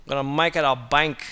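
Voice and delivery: normal talking voice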